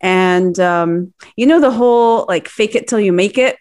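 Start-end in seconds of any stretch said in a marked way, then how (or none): none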